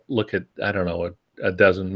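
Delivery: normal talking voice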